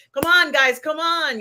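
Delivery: rally the guys with a try at his charm; attempted sexy accent. Podcast host imitating Andor rallying fellow prisoners, mock sexy call. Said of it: "I can't do his sexy accent".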